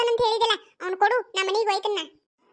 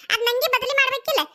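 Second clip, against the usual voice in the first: put-on child voice; 4 semitones higher than usual. Child asks her mother.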